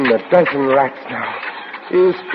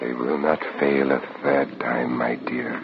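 low whisper; 9 dB quieter than usual. Acted. Menacing low whisper in dungeon.

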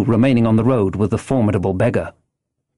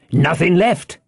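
higher-pitched buccaneer reporting; pirate henchman's high reply; another fellow; 7 semitones higher than usual. A narrator's reading. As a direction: call out the report in a raised voice. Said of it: "said he".

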